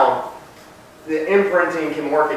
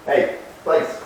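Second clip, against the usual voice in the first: ordinary delivery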